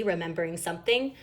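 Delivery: ordinary delivery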